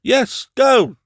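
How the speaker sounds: high, loud shout. A narrator's reading. Doctor shouting after Sir Thomas and the boy, loud shout. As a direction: call them off with a shout. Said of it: "shouted the doctor".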